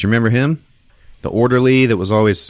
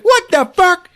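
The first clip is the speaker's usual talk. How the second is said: very high hammed-up alarm; mock shriek; 3 dB louder than usual. Woman seeing his panther eyes, mock shriek. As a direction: imitate her squealing in shock.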